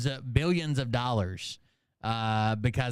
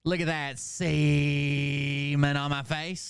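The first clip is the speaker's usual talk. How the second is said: mock parody; slower than usual. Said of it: "parodying the complexion line".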